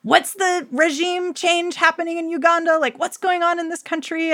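high, anxious mock student voice; lightly voiced confused teenager; imitating a flustered student. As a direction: play a panicked Model UN kid cramming facts. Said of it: "And you're like".

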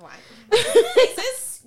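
high, loud laughing word. Real, not acted. Joking with her co-host, laughing. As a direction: burst out laughing on it.

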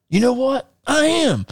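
very high squeaky retort; mock outburst, voice shooting way up; riled-up reply. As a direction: squeal it as a comic comeback. His riled reply in the story.

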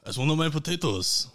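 put-on French farmer's accent. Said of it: "Pretty good accent".

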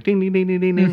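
high sung ding ding; bell dings. Retold or imitated. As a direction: imitate a tiny bell. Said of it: "like Morse code or something".

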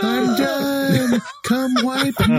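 put-on high, whining little kid; whiny toddler voice. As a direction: call out like a toddler on the potty.

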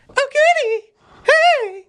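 put-on very high, loud squeaky girl voice; shop girl impression. Impression of the young employee greeting customers, very high and squeaky. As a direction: squeak it out like the cashier. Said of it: "best impression of her".

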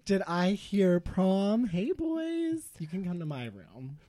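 creepy ghost voice. Acted.

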